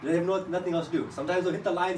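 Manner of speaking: ordinary delivery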